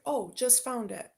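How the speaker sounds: ordinary talk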